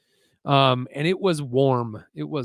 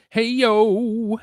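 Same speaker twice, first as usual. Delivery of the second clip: high, loud drawn-out hail; calling out; 9 semitones higher, 7 dB louder than usual. Acted. Streamer reading a viewer's greeting, calling out. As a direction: sing out the greeting at full voice.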